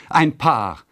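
high-pitched announcement; exclaimed call. A narrator's reading. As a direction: call it out as glad news. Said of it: "riefen sie aus".

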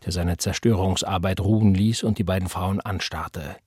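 plain reading voice

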